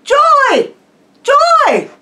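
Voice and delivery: very high, full-voiced shout; loud, high-pitched cry of joy; joyful shouting. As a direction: shout it out across a windy beach, pausing between each. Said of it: "realized that she was shouting".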